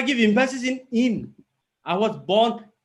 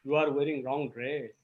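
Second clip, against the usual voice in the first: lower, softer aside to a companion; companion's quiet remark; 7 semitones lower, 8 dB quieter than usual. Shown as a demonstration. Companion at the bus station to a colleague, quiet remark. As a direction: say it low and soft, as a passing remark. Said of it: "person who is accompanying you, he says".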